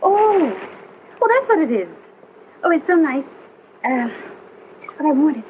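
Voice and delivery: disappointing gift. Acted. Unwrapping a disappointing incense burner.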